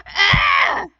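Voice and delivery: high, loud tantrum shriek; tantrum scream. A narrator's reading. Bird throwing a tantrum at her uncle, shrieking. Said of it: "had tantrums".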